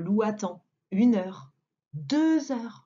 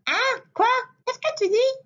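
high, quick teasing rabbit; very high cheeky bunny; little rabbit voice; 4 dB louder than usual. Acted. (voix aiguë de petit lapin)

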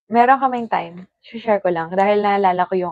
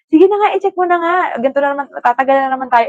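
higher, louder and fast, half laughing in defeat; giving up; 6 semitones higher, 6 dB louder, faster than usual. Retold or imitated. Couple giving up on the song guessing, loud fast surrender. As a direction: blurt it out, fine, just look it up.

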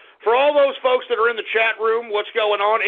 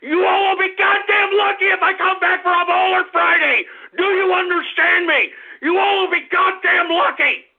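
real on-air tantrum; angry shouting; 5 semitones higher than usual. Real, not acted. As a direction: yell it out like you're fed up. Host ranting at his audience.

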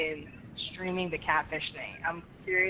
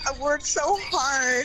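mock whine; 8 semitones higher, slower than usual. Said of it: "I'm like".

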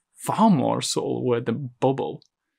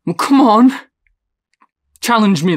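higher, louder taunting call; goading shout; 9 semitones higher, 8 dB louder than usual. Acted. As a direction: provoke them into answering. Goading someone.

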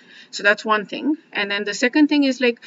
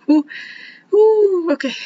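choked up; 9 semitones higher, 4 dB louder than usual. Real, not acted. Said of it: "okay, I need a minute".

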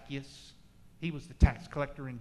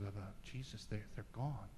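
timid woman; 7 semitones lower, 11 dB quieter than usual. Acted. Woman answering Jesus timidly.

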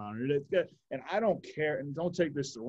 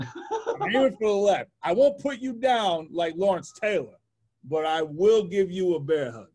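laughing mock threat; 5 semitones higher, 5 dB louder, slower than usual. Repeats the bear hug joke laughing.

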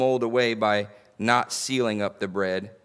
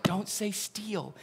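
high, soft, slow mock scold; mock warning; 7 semitones higher, 9 dB quieter, slower than usual. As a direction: play Chase hushing him, gently teasing. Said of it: "Chase is like".

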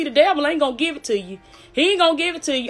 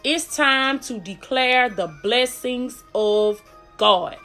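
very slow, deliberate proclamation; slow emphatic declaration; much slower than usual. Real, not acted. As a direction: draw out each word at half speed.

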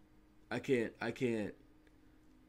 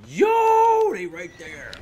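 sudden loud high yell; startled shout; 9 semitones higher, 14 dB louder, much slower than usual. Real, not acted. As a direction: blurt it out in alarm. Spots enemies suddenly.

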